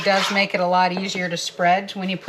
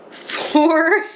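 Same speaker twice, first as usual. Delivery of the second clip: a high word that breaks into a giggle; giggling; 7 semitones higher, 4 dB louder than usual. Real, not acted. Counting her freezer meals to viewers, giggling. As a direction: start the count, then crack up giggling. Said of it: "I don't know why I'm giggling".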